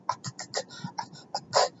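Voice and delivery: stuck on hard whispered k sounds; performed stutter. Acted. Student unable to form words, struggling stutter. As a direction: choke on the consonant, then push the words out. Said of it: "I can't form words".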